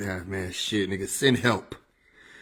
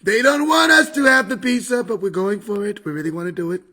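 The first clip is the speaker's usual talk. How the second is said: higher, rally-style politician caricature, starting loud; Trump; 8 semitones higher, 6 dB louder than usual. Acted. Comedian as the president defying pizza opponents, Trump impression. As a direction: open loud and high, then ease off. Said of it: "Trump impression was spot on".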